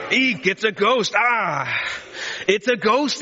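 a mild play-scream at seeing a ghost; mock scream. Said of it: "the disciples scream as they look up".

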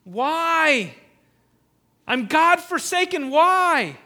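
high, loud cry of abandonment, voice straining upward; anguished cry. Retold or imitated. Preacher voicing Jesus on the cross, loud anguished cry. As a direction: cry out to the heavens in despair. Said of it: "and he's crying out".

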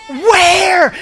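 high, loud eager ask; loud question. Acted. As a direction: call it out. Congregation asks.

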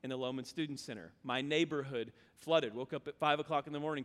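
low, soft, somber retelling; hushed somber. Real, not acted. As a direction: drop the voice for the disaster. Recounting hurricane flooding.

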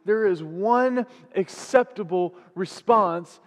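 slow, weighty sermon delivery with strong stresses; emphatic preaching. Real, not acted. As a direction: drive each phrase home to the church.

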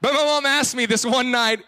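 normal talking voice

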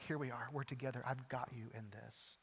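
low, soft promise of togetherness; steady reassurance. Acted. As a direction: comfort someone in the middle of a storm. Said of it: "he's like".

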